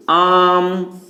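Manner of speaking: "आम" with the आ held long, about three times normal; prolonged vowel demo. Shown as a demonstration. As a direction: draw out the आ of "आम" before closing on म. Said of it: "इसका उच्चारण तिगुने समय में करना है".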